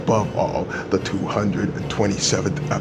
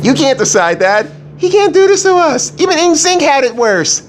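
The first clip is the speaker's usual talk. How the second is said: much higher, loud outraged yell; outraged shout; 7 dB louder than usual. Pretend. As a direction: shriek in mock outrage. Mock outrage after club disbanded.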